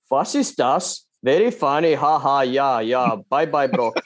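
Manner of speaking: mock German accent, short dry phrases. Imagining Porsche's side of the call, in a German accent. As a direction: put on a comic German accent. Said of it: "you're not allowed to do a German accent".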